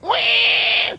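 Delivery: very high-pitched mimicked squeal; imitated weird animal wail. Showing the weird sound the animal made, imitated squeal. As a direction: screech it like the strange animal. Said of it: "it was making this weird ass".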